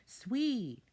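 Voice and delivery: high, loud delighted cry; excited kid. Acted. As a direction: cheer it. Kid celebrating.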